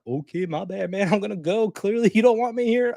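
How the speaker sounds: high, huffy nurse voice; mock offended nurse. Nurse brushed off by an angry son, high mock huff. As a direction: play the nurse taking offence and leaving.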